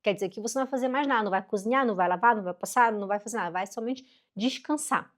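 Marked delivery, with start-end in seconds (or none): none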